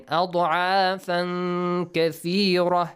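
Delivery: أَضْعَافًا كَثِيرَةً recited with the n hummed before k; ikhfa. Shown as a demonstration. Teacher repeating ikhfa, أَضْعَافًا with long nasal link into كَثِيرَةً. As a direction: hold the hidden n between أَضْعَافًا and كَثِيرَةً. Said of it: "Ikhfa again".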